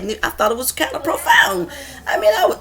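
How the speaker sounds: rising into a high laugh; words breaking into rueful laughter; laughing at oneself. Real, not acted. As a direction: laugh through the embarrassment. Laughs at her lost speech.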